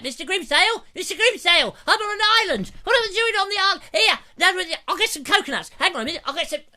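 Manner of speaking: very high, loud, squeaky panicky clown; bad Norman Wisdom. Norman Wisdom stranded on an island, panicking loudly. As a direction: flap about as a stranded pantomime fool. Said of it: "That was a really bad impression".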